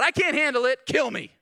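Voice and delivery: loud high outburst; Moses despairing. Retold or imitated. Preacher voicing Moses to God, loud high despair. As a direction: blurt it out at the end of your rope. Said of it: "Moses told God".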